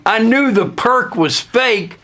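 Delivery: usual speaking voice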